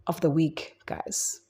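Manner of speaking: whispered teaser to the viewers; breathy whispered tease; playful whisper. Pretend. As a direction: whisper it like a little secret. Teasing snack segment intro.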